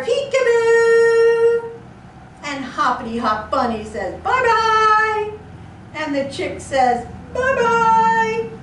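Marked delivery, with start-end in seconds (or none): 0.0-1.7 high, loud, slow drawn-out peekaboo; excited peekaboo. Pretend. Animals revealed under umbrella. 4.2-5.3 high, louder cartoon bunny voice; bunny goodbye. Bunny character says goodbye. 7.3-8.5 high, louder chirpy chick voice; chick goodbye. Pretend. Chick character says goodbye.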